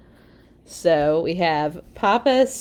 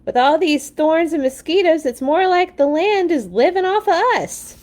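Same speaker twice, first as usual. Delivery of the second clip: higher, raised complaining voice; indignant Mama; 8 semitones higher, 3 dB louder than usual. A narrator's reading. Mama complaining to Papa on the run, raised high voice. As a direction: keep grumbling up high as you run. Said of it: "shouted Mama".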